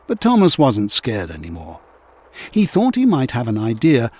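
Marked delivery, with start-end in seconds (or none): none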